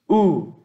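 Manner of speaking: "U" as one long oo, pitched high; vowel sound demo. Shown as a demonstration. Teacher showing omicron plus upsilon, "U" as a long high oo. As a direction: sound out "U" as a single drawn-out oo. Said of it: "Isso aqui".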